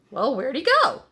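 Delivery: loud, anxious question; alarmed horse asking about Tommy. A narrator's reading. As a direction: ask it in alarm. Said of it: "asked the horse, who was quite alarmed".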